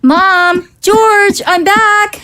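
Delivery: calling out. Acted. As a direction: call out to family on coming home. Calling out on arriving home.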